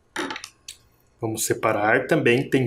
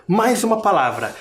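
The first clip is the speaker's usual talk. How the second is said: normal talking voice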